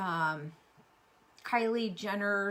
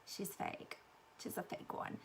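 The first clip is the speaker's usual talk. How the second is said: mock whisper of people judging; mock whisper of a stranger; whispered gossip; 13 dB quieter than usual. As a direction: whisper it like a snide bystander. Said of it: "people are gonna look at me and go".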